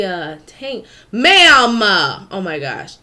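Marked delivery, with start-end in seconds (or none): 1.1-1.8 shouted, higher "Ma'am!"; loud call-out. Pretend. Calling herself out loudly. 1.8-3.0 laughing through "oh my gosh". Real, not acted. Laughs at herself.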